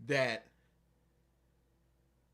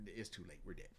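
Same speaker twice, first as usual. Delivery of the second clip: much lower and softer despair; hopeless murmur; 12 semitones lower, 17 dB quieter, faster than usual. Retold or imitated. Young partner facing the bomb, low hopeless murmur. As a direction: give up under your breath.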